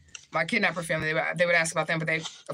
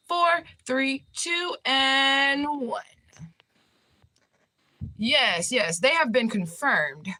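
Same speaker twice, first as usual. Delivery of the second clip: higher breaking-news anchor voice, slowed on key lines; playing a reporter breaking news on her own case, higher anchor voice; mock news read; 4 semitones higher than usual. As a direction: read it like a TV anchor with a big story.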